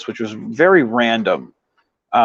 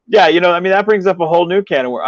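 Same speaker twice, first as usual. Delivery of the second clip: amused laughing; 6 semitones higher, 4 dB louder, faster than usual. Real, not acted. Still laughing at the question.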